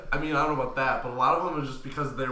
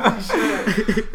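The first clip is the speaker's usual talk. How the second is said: slow, laughing exclamation; drawn out through laughter; 7 semitones higher, 4 dB louder, much slower than usual. Real, not acted. Laughing after story.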